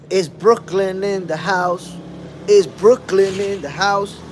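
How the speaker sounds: slow, drawn-out hype-man call. Pretend. Livestream host rallying Brooklyn viewers, slow hype call-out. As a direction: call out to the crowd, stretching it.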